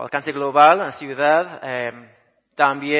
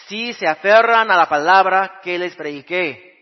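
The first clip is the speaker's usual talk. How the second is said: higher and louder proclaiming reading; scripture reading; 5 semitones higher, 7 dB louder than usual. Acted. Pastor rereads First Corinthians fifteen to the church, proclaiming. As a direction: proclaim the apostle's words, slowing on key lines.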